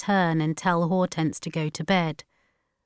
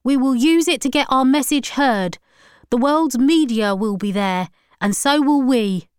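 raised, loud campaigner's voice; declaiming preacher; 7 semitones higher, 6 dB louder than usual. A narrator's reading. Preacher promising to reach the world's media, declaiming. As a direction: announce it like a proclamation. Ryan announces his plan.

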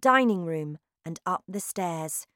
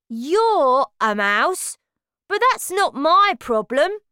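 high, loud and slow, brushing it off; dismissive; 8 semitones higher, 9 dB louder than usual. Acted. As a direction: shrug off someone else's problem. Bruno brushing off the narrator.

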